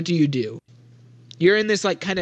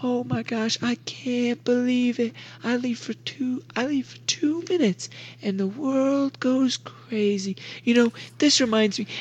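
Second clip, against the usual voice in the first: exasperated complaint pitched well up; Miss Sandella; 7 semitones higher than usual. Acted. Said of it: "And Miss Sandella is like".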